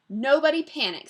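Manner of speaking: storybook teacher taking charge loudly; urgent reassurance. Acted. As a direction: call out to settle everyone. Teacher calms startled class, story.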